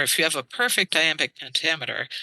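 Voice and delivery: ordinary talk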